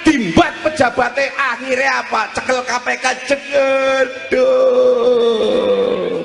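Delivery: high-pitched, forceful sermon delivery; preaching. Real, not acted. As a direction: drive each short phrase home like a preacher.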